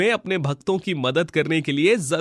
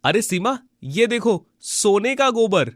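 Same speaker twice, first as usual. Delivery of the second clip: excited discovery; 3 semitones lower than usual. Acted. Husband shows wife his discovery.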